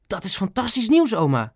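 high-pitched delighted outburst; excited exclamation. A narrator's reading. Ben hears grandma is healthy, exclaiming. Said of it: "riep hij uit".

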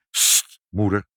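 sharp hush, then the name as a warning; urgent shushing. A narrator's reading. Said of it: "riep vader dringend". (dringend sussend)

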